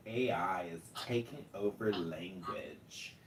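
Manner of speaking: low creaky vocal fry. Real, not acted. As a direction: drag the words in deep vocal fry. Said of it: "That is the worst vocal fry I've ever heard".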